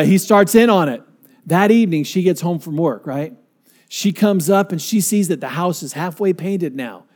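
ordinary talk, nothing marked

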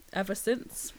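usual speaking voice

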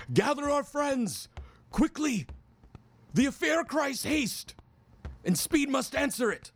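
high commanding call; rally them with noble haste; urgent archaic hero. Acted. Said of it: "and he yells at you".

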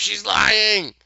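very loud, high accusing scream, imitated. Miranda denouncing Tip to the witch, acted screaming. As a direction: scream the accusation at full volume, hammed up. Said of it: "And Miranda screams".

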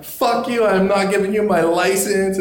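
high-pitched mock defiance; belligerent. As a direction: imitate a friend refusing the cop. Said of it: "so belligerent".

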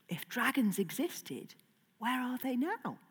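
whispered. Real, not acted. Mysterious question to children.

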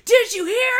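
high, loud breathless news; excited. Retold or imitated. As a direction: burst in with big news. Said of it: "jumped out of your room".

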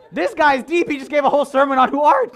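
high, loud awestruck listener; imitating a wildly impressed churchgoer; mock amazement. Congregation responding to the sermon, hammed-up amazement.

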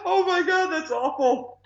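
high-pitched, helpless laughter through the words; laughing hard. Real, not acted. Host reacting to co-host's awful Bond impression, laughing. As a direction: squeal it out while cracking up.

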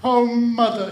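high, loud acted childish sob; acted tearful outburst; sobbing. A narrator's reading. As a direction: wail it like an upset child. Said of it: "she sobbed".

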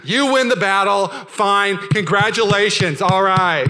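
loud, drawn-out fake congratulations; hammed-up sarcastic surrender; passive-aggressive. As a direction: stretch the words out in mock defeat. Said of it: "totally passive-aggressive".

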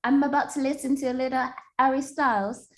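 high playful British put-on voice; sing-song Brit greeting, hammed up; mock British accent. Her personal cheese-day narration, in a mock British accent. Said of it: "Your personal narration?".

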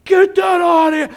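full-volume, high-pitched yell; uncle screaming. Retold or imitated. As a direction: scream it at the top of your voice. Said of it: "my uncle starts screaming".

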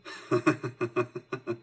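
low chuckle; acted low, rolling laugh; dad's laugh. A narrator's reading. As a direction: chuckle low like an amused dad. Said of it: "Daddy Peters laughed".

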